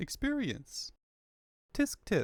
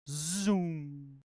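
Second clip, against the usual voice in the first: spoken zoom. Acted. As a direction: voice the zoom sound. Spoken sound effect.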